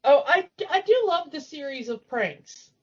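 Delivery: ordinary talk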